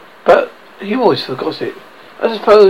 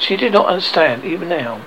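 ordinary delivery

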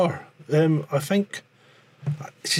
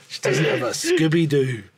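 normal talking voice

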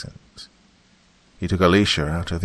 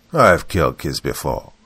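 savoring, smirking; 5 dB louder than usual. Acted. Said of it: "savored his words through a smirk".

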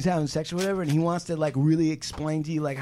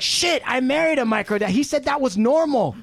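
higher-pitched imagined realization; mock shocked sister; 6 semitones higher than usual. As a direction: act out her dismay, pitched up. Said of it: "her sister will be like".

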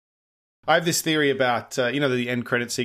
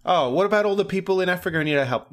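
higher-pitched mock concern; mock plea; 6 semitones higher, 3 dB louder than usual. Film fan voicing a mock objection for Africa, higher mock plea. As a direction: plead on others' behalf in a lightly put-on higher voice.

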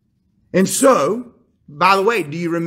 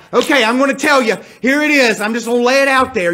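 emphatic and quick; 4 semitones higher, 4 dB louder, faster than usual. Retold or imitated. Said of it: "a statement like".

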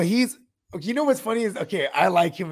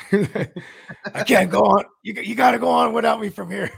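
dramatic dying farewell; 4 semitones higher, 4 dB louder than usual. Pretend. Acting the injured player's farewell.

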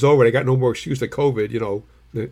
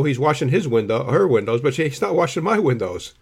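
ordinary talk, nothing marked

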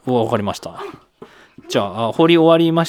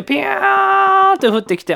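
whooshing sound effect; 6 dB louder, slower than usual. Pretend. Mimics falling meteor sound.

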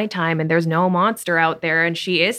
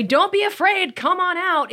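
much higher-pitched, over-eager coaxing; bursting-in encouragement; 12 semitones higher than usual. Acted. Woman mimicking the wrong way to coax a scared child, high and brash. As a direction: burst in with a high, pushy call. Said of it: "You don't just, like, bust open the door".

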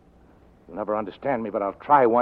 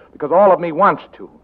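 higher, louder burst of longing; passionate outburst; 6 semitones higher, 8 dB louder than usual. Acted. Detective admitting he wants her, loud burst. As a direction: let the want break through.

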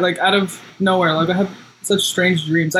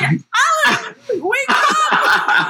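high, panicked calls broken by laughter; half-laughing mock alarm, trying to rouse Alan; playfully panicked shouts to wake a sleeper; frantic wake-up call; much slower than usual. Acting out waking a sleepwalker.

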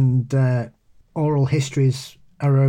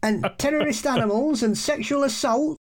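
outraged pub friend; 10 semitones higher than usual. Retold or imitated. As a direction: play the friend baffled and indignant, pitched well up. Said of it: "he came in, he went".

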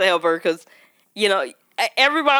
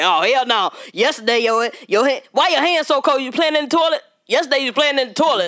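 single dad; 6 semitones higher than usual. Retold or imitated.

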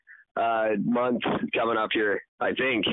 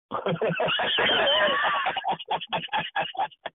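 high laugh. Real, not acted. Laughing at own joke.

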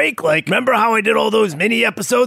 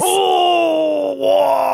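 anguished wail; 6 semitones higher than usual. Acted. Grief outburst.

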